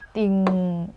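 low "띵" with a long ng; tense t, hum out the end of "띵"; teacher's drawn-out model of "띵"; demonstrating "띵". Shown as a demonstration. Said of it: "이렇게 되겠죠".

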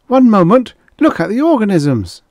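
high, loud bid for attention; urgent call. Acted. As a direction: call out to halt and divert them. Stalling attackers, pointing away.

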